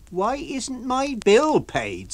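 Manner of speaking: high, slow retort. Acted. Landlady answering the lodger's complaint. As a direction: throw his question back at him.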